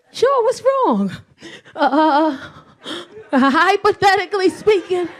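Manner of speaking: put-on high, nervous, laughing girlfriend; nervous sweet voice. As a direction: ease him into bad news nervously. Nervously asks boyfriend, laughing.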